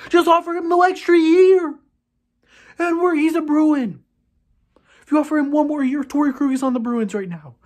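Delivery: raging. Real, not acted. Fan fuming about losing Torey Krug, raging. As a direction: spit out the obvious fix in disbelief. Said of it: "I'm really raging right now".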